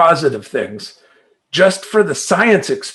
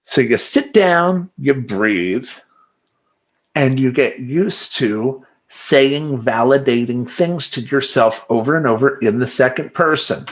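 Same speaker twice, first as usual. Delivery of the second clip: ordinary delivery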